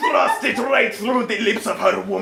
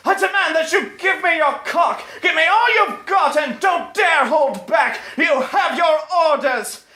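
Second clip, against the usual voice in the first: much higher, hammed-up commanding lady; Integra demanding; 8 semitones higher than usual. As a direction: bark orders in a high mock-imperious voice. Integra commanding her servant.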